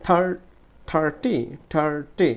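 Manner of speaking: Thirty with a strong "thar", light "ti"; stressed first syllable. Shown as a demonstration. Teacher drilling students, forceful "thar" in thirty. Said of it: "জোরে উচ্চারণ করতে হবে".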